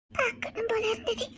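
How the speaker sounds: tearful. Acted. Tearful wife visits husband.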